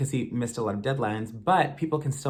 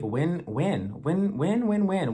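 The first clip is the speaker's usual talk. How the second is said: each when climbs higher, pleading and fed up; exasperated repeating; 4 semitones higher, slower than usual. Real, not acted. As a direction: hammer the question, rising each time.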